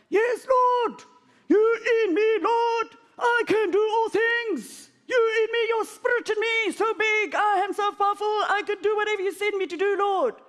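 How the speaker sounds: high, loud, bold proclamation; fired-up faith declaration; triumphant declaration. Retold or imitated. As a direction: shout your victory.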